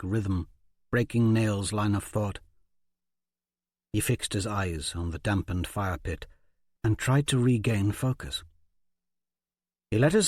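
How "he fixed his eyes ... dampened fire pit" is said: usual speaking voice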